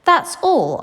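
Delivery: proud. A narrator's reading. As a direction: say it like a proud inventor showing off. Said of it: "gazing proudly at the result".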